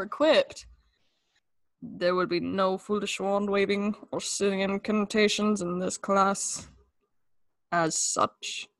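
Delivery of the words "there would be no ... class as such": a hint of a cold professor, slow; quiet mock-menacing drawl; Snape impression. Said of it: "Severus Snape".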